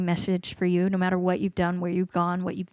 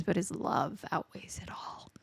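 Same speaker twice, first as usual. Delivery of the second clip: whisper; 10 dB quieter than usual. Real, not acted. Tender sermon on God's love.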